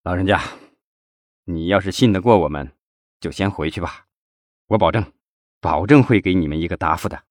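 mildly sorrowful, subdued promise; saddened. A narrator's reading. Former secretary promises the old man an answer, mildly saddened. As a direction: gently send him home with a touch of sorrow. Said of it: "赵东林难过地说".

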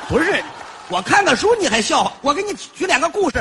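indignant. Acted. Indignant at being laughed at.

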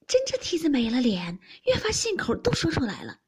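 playful, exclaiming rebuke; amused scolding. A narrator's reading. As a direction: tease her with mock shock. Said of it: "平儿袭人笑道".

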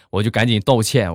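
ordinary delivery, nothing marked

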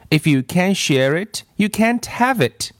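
mildly acted playground ultimatum; laying down terms. A narrator's reading. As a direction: argue it firmly, pitch climbing at the end. Acted quarrel over the ball.

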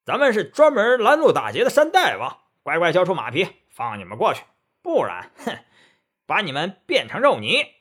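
loud, high threatening yell; bandit chief's shout. Acted. Bandit chief shouting threats at the monks, loud and fierce. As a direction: roar the threat like a bandit boss. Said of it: "那强盗头子喝道".

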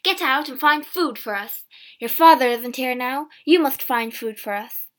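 loud, insistent scolding order; shouting. A narrator's reading. Mother sends James out for food, shouting. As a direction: shout at him to act. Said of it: "she shouted".

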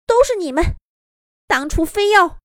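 high, agitated rabbit blurting out; excited outburst. A narrator's reading. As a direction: burst out high and upset. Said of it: "小兔子突然激动地说了一句". (小兔子激动地脱口而出)

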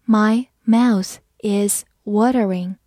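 slow, word by word; slow read-along. Shown as a demonstration. Teacher leading learners through the phrase, slow and separated. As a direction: read it slowly with each word apart. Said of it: "好，我们慢慢来读".

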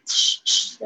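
slow rounded-lip "ch，ch" hiss; ch sound. Shown as a demonstration. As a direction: lips pushed forward, "ch" unvoiced. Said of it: "嘴巴稍微微微嘟起来". (嘟起嘴唇慢慢发"ch")

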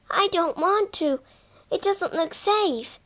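put-on nervous child holding back; scared younger sister refusing to climb down; frightened. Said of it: "Phyllis sounded frightened".

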